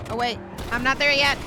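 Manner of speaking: ordinary talk, nothing marked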